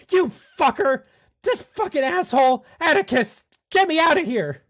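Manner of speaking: high-pitched, full-volume cursing; shrieked captive outburst; frantic yelling. Acted. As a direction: scream for rescue, furious and panicked. Gagged captive cursing as tape comes off.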